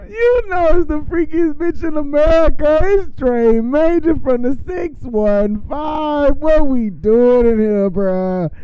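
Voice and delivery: loud high hype-man intro; hype shout. Acted. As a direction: shout it like firing up a crowd.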